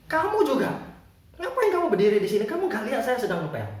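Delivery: high, loud acted outburst; angry. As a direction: snap at a bystander as the angry janitor. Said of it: "Dia marah".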